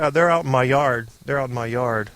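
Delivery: ordinary delivery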